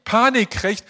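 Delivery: ordinary delivery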